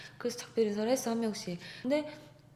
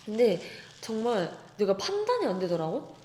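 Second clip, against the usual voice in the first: ordinary talk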